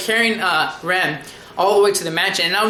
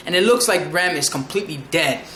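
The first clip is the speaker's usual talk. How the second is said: usual speaking voice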